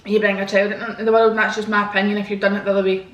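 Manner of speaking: ordinary delivery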